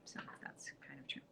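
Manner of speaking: whispered aside under the breath. Real, not acted. Baker admitting the story to her cohost, whispered aside. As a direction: concede it in a hush. Quiet aside after being corrected.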